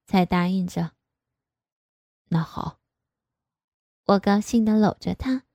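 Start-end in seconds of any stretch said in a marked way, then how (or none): 2.3-2.8 hesitant agreement. A narrator's reading. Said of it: "爸爸犹豫了一会儿".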